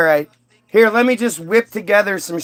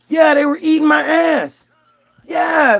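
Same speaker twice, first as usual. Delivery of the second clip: animated complaint; 7 semitones higher than usual. Acted. Adam explains itching to God.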